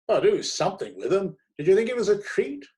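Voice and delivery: high and loud; booming editor. A narrator's reading. Editor to the reluctant critic, loud and high. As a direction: bluster it cheerfully.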